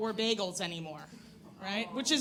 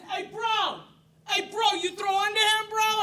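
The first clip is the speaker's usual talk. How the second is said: high, loud jock bro voice; bro heckler; 8 semitones higher, 4 dB louder than usual. Retold or imitated. Softball women heckling her over the fence, high loud bro voice. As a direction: holler like a softball player at the fence.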